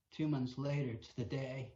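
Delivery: ordinary talk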